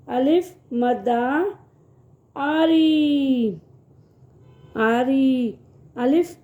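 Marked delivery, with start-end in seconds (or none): 2.3-3.5 "آری" with a long stretched آ; long drawn آ in "آری", teacher demo. Shown as a demonstration. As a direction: hold the آ of "آری" slowly. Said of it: "اس کی آواز تھوڑی کھچ کے آتی ہے".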